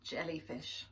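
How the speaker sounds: ordinary delivery, nothing marked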